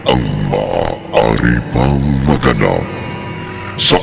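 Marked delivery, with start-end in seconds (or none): none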